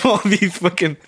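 ordinary talk, nothing marked

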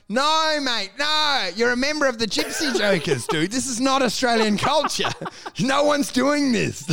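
comic exasperation, setting him straight; exasperated protest. Acted. Telling Wacker he has missed Australian culture, exasperated.

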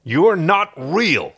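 loud, slow, high-pitched rejection; harsh denial. Acted. Harvey remembers Crichton's hurtful words, harsh denial. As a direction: drive every word home, loud and slow.

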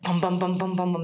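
rapid, even thumping syllables; pounding heartbeat. Retold or imitated. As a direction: imitate a racing heartbeat. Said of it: "my heart was just like".

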